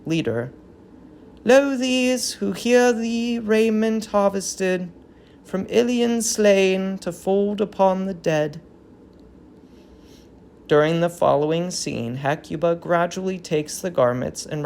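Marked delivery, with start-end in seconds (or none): 1.4-8.6 measured, solemn verse; solemn chorus line; dramatic verse reading. Acted. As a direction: lay out the offering gravely. Leader speaks chorus verse.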